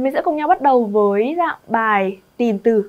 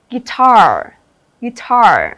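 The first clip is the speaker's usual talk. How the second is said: "guitar" as gui-TAR, second syllable loud and falling; stress on second syllable; 6 dB louder, much slower than usual. Shown as a demonstration. Teacher drilling word stress for the class, gui-TAR punched. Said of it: "Đáp án B, âm thứ hai".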